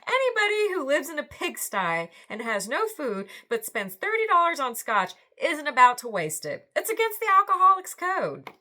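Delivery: a hint of a know-it-all drunk explaining; lightly voiced stranger. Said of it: "he says".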